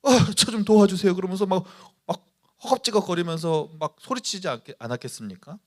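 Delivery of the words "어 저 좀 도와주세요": higher, louder desperate plea; mock urgent shout for rescue; urgent cry for help. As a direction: cry out to Jesus like a drowning man. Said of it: "다급하게 외칩니다".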